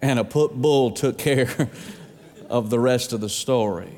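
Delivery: talking through a laugh. Real, not acted. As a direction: finish the line while still chuckling. Laughing through the joke ending.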